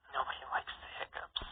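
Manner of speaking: toneless whisper, barely there; gloomy whisper. Acted. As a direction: breathe it out with no enthusiasm at all. Goth sister whispers.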